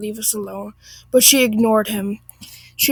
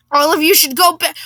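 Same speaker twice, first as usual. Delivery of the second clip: irate Karen; 4 semitones higher, 5 dB louder than usual. Acted. Acted Karen outburst, cut off.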